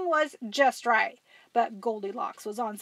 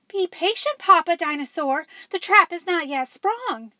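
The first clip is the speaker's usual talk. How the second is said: sneaky dinosaur; 7 semitones higher, 5 dB louder than usual. Acted. Dinosaur voice in story.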